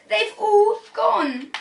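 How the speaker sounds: slow, high, tearful wail; a boy crying over lost books; hammed-up sob. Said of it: "cried out Peter".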